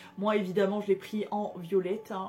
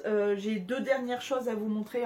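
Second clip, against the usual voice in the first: normal talking voice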